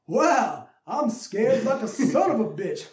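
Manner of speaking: slow, mock Southern accent; hammed-up Keanu-style Southern twang; bad Southern accent. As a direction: do a dopey, badly faked Southern accent. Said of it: "but that, that accent".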